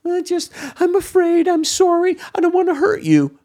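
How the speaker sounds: high, whimpering mock child voice; hammed-up frightened child pleading; timid child. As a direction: mimic a scared little kid begging.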